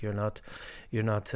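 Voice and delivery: ordinary talk, nothing marked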